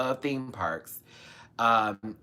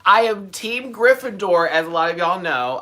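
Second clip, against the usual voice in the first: excited; 7 semitones higher, 9 dB louder than usual. Real, not acted. Proud fan declaration.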